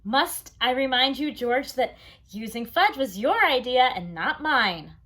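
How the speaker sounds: father reminds the client whose idea it was; firm reminder. Acted. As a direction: put George in his place. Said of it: "my father said".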